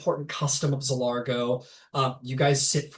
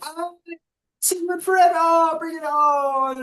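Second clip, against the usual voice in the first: much higher, gushing family welcome; delighted greeting; 4 dB louder than usual. Acted. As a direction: throw your arms open and squeal hello. Family greets arriving guests.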